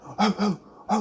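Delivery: high yelping "oh, oh" like a barking dog; mock dog barks, high and quick; dog bark imitation. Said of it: "I heard my dog barking. He's like".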